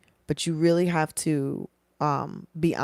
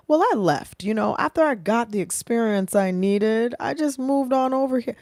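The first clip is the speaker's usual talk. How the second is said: high mock candidate voice; 7 semitones higher, 4 dB louder than usual. Speaker acts out job candidate.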